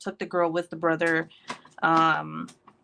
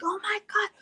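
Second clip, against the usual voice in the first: much higher, soft hammed-up gasp of fear; mock panic; 5 dB quieter than usual. Mom seeing her kid nearly hit, hammed-up panic. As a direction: pretend the kid is about to be hit. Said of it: "I'm that mom that's like".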